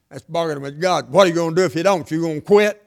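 ordinary talk, nothing marked

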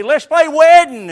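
loud, high eager invitation; a kid rallying friends to play; preacher voicing an eager child calling out a game. Children's game quarrel acted out.

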